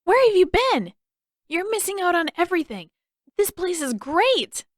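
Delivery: high, loud and eager; excited. Acted. Boy greeting his friend, bubbling excitement. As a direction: gush with boyish enthusiasm.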